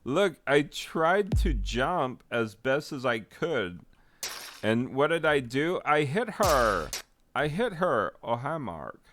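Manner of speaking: overwrought, high-pitched movie-star imitation; cult movie impression. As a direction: wail the denial like a wounded B-movie hero. Same movie impression again.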